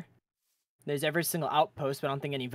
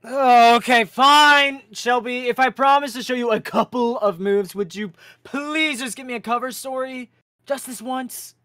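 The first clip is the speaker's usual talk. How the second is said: high, loud pleading deal; bargaining teen; 9 semitones higher, 10 dB louder than usual. Acted. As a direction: talk her into it eagerly. Leo bargaining with sister.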